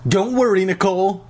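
high-pitched, earnest reassurance; distressed girl. A narrator's reading. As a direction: voice an upset girl consoling her sister. Lauren consoles frozen sister.

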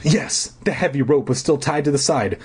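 ordinary talk, nothing marked

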